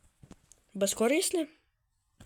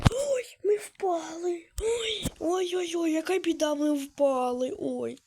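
hammed-up slow wail over the fall; mock wailing; 4 semitones higher, slower than usual. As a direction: lament it like a fake tragedy. Hammed-up lament over falling. (награний плач, що впали)

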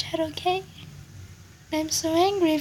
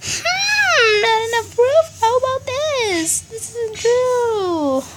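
mock crying; 8 semitones higher, 4 dB louder, slower than usual. Mock crying over proof.

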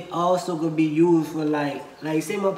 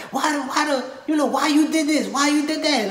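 mock screaming; 8 semitones higher than usual. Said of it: "if I was just screaming".

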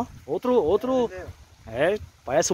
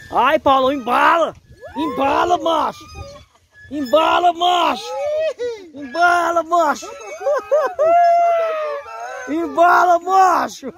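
high, loud, repeated cries of embala macho; excited yelling; 7 semitones higher, 3 dB louder than usual. Real, not acted. Man on the swinging boat yelling at Paulo, loud and high. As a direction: whoop and yell as the boat swings higher.

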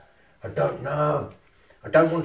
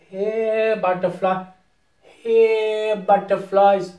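high, singsong call to little creatures; 5 semitones higher, 5 dB louder, much slower than usual. Pretend. Player calling virtual butterflies over, playfully calling out. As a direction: coax them over with a raised, lilting call.

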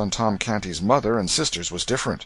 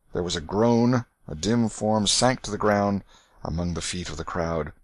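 plain reading voice

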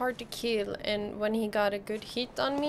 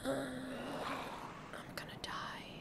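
slow, barely voiced whisper; whispered dread; 3 semitones higher, 12 dB quieter, much slower than usual. Real, not acted. Gamer facing death in game, whispered dread. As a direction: whisper it as if doomed.

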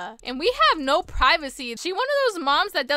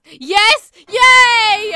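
loud, high celebration; excited cheer; 6 semitones higher, 11 dB louder than usual. Pretend. Orphan griffin finally gets a mom, cheering loudly. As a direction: cheer out loud, stretching the yay. Celebrates getting adopted in game.

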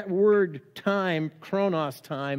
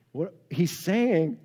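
usual speaking voice

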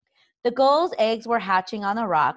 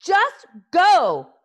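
repeated high, loud shouts climbing to a shriek; frantic scream; 9 semitones higher, 10 dB louder, much slower than usual. A narrator's reading. Hiccup flapping his arm frantically, screamed command. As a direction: scream the order over and over, ever more desperate. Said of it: "screamed Hiccup".

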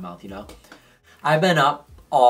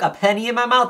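incredulous; 9 semitones higher, 3 dB louder than usual. Retold or imitated. Repeating nurse's words in disbelief.

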